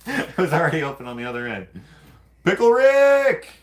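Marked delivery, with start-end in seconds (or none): 2.4-3.5 high, loud, drawn-out cartoon shout; Pickle Rick shout. Showing the cut-open tray to viewers with a high cartoon yell.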